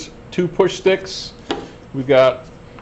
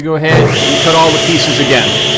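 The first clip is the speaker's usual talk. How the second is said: raised voice over machine noise; shouting over table saw; 2 semitones higher, 6 dB louder than usual. Real, not acted. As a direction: project loudly over the saw. Cutting with saw running.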